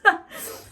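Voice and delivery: airy laugh through the nose; breathy giggle at herself; breathy high giggle. Real, not acted. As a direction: giggle without voice.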